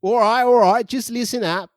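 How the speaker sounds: hammed-up prank caller giving orders; mock caller demanding a broom on speaker; crude phone caller. S0 role-plays crude phone caller.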